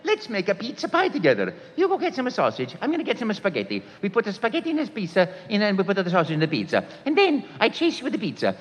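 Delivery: put-on cheerful young Italian plumber inviting kids to cook; Mario voice. Auditioning as Mario for the producer, high and joyful. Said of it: "I'll just do that nice voice".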